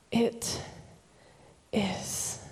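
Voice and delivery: hushed, one word at a time; long pauses between words, fading to breath; spent, solemn near-whisper; dying words. Acted. Preacher voices Jesus's dying words.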